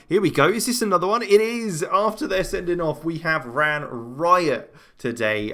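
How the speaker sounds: high-pitched excitement, settling and slowing at the end; excited goal call. Real, not acted. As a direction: ride the attack into the goal. Another goal in live commentary.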